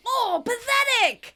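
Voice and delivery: high, drawn-out acted shout; over-the-top mocking yell. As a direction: shout it out loud, stretching the words. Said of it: "Rachel shouts".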